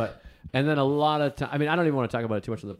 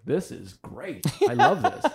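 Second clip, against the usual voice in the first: mock gushing; 5 semitones higher, slower than usual. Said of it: "I'm like".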